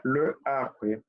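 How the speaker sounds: le arbre with French lø and uvular r; pronunciación francesa; French pronunciation. Shown as a demonstration. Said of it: "Yo voy a decir el árbol".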